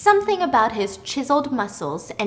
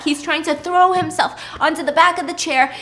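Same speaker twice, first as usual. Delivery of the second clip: normal talking voice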